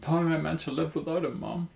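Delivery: high, fast, tearful plea with a sob in it; sobbing plea. A narrator's reading. Daughter asks her mum through sobs, high and fast. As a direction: beg through tears, pitched high and rushed. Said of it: "she sobbed".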